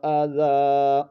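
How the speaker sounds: slow, melodic, each word set apart; teacher's model recitation; Quranic recitation. Shown as a demonstration. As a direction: chant the verse with long pauses. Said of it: "آزا۔".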